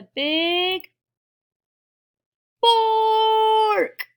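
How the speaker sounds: very loud, high, long BARK; huge bark. Acted. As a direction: bellow the word like a watchdog alarm. Acts watchdog's big bark.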